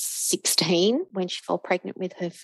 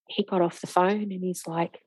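normal talking voice

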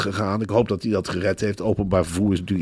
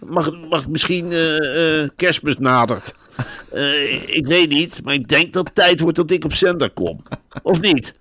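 higher-pitched, mock-urgent reporter; pushy mock newsman, pitched up; insistent reporter imitation; 6 semitones higher, 3 dB louder than usual. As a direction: lightly parody a reporter demanding airtime. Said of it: "dat hij daar heel dwingend is".

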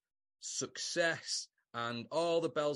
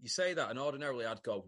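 normal talking voice

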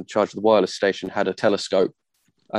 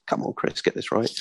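whispered note to self, under the breath; muttering to self; 6 dB quieter than usual. Real, not acted. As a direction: hush it to yourself, annoyed at your own slip. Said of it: "muttering to himself".

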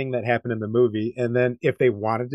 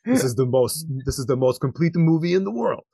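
higher-pitched mock Eastern European accent; playful Boyka-style Eastern European accent; 4 semitones higher than usual. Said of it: "just do the Boyka accent".

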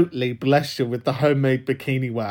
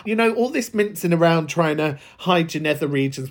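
ordinary talk, nothing marked

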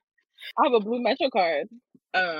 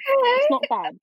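put-on much higher British accent; squeaky exaggerated British take; slower than usual. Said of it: "let's hear your British accent".

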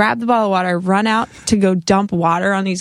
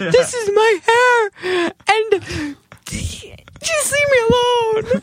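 very high, drawn-out mock wail; mock-hysterical shout, pitched way up; hammed-up self-parody; 14 semitones higher, much slower than usual. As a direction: stretch each word in a high, slow protest. Mocks her own outburst, playing along.

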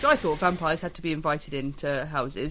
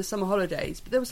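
ordinary delivery

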